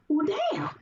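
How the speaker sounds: high, put-on voice of a let-down guy shrugging off the rejection; mocking a deflated guy. Man walking off after rejection, high mock deflated voice. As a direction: pitch it way up and let the air out of it. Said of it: "He was like".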